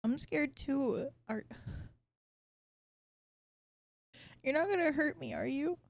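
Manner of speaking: put-on high, hesitant child voice; scared little boy. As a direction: ask timidly as a frightened child.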